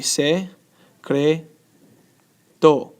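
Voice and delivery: "se, cre, to" with clear pauses between; syllable by syllable. Shown as a demonstration. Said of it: "So it'll be".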